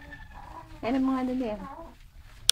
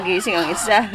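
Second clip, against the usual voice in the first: ordinary talk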